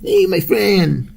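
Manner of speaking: high, slow, drawn-out caricature greeting. Pretend. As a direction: stretch it out like a cartoon character. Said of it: "That's a stereotypical voice".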